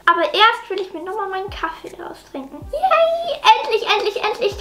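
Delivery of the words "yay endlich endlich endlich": much higher delighted squeal; excited cheer. Acted. Daughter cheering at the makeover plan, high excited squeal. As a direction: burst out with joy.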